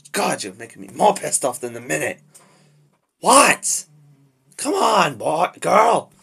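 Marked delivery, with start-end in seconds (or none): 3.2-6.1 high, very loud angry yell; shouted, confrontational challenge; lost temper. Acted. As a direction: explode at whoever is staring. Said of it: "I just lost my temper".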